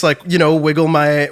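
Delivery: ordinary talk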